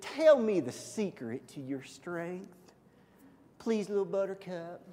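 Southern sweetheart angling for a secret; Southern accent. Acted. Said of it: "pictured as a girl with a Southern accent".

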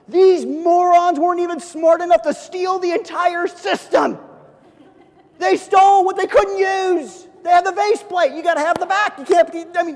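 high, loud mock rant at the thieves; hammed-up outrage, shouted; mock furious rant. Young man ranting at the thieves, hammed-up furious shout. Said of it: "I remember going".

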